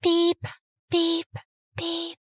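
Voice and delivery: flat machine beeps fading out; high electronic beep, fading; monitor beeps. Acted.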